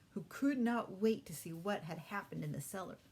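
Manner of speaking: plain reading voice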